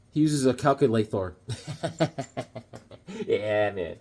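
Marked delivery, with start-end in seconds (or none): 1.4-2.7 soft staccato chuckle; quiet run of chuckles; laughing. Real, not acted. Laughing at own Thor pun, soft chuckle.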